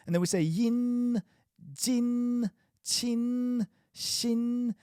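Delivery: yin, jin, chin and shin modelled high. Shown as a demonstration. Teacher modelling yin, jin, chin, shin, high. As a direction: model each syllable crisply. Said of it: "Make that sound to yourself".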